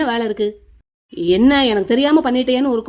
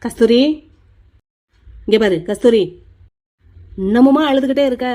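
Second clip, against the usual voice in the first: loud calling of her name; stern call; 4 dB louder than usual. Acted. Brother calls his crying sister by name, loud and firm.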